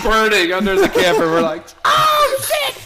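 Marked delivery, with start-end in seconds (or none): 1.8-2.8 very high squeaky panicked "oh shit"; shocked squeal. Retold or imitated. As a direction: shoot way up in alarm. Flaming gas can under camper.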